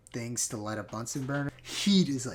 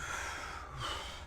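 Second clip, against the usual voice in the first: long smoky exhale; 12 dB quieter than usual. Real, not acted. Exhale of smoke.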